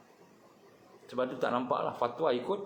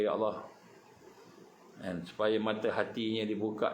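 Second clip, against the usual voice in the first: earnest prayer; 3 semitones lower than usual. Acted. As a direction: pray humbly for him. Prays for K J and D J. (doa yang khusyuk dan perlahan)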